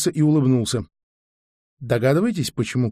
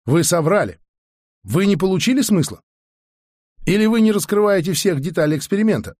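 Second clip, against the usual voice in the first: higher-pitched pressing questions; demanding accusation; 6 semitones higher than usual. A narrator's reading. Di accusing the narrator over the experiment, demanding. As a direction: press for the truth. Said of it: "требовательно спросил Ди".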